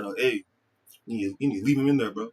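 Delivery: usual speaking voice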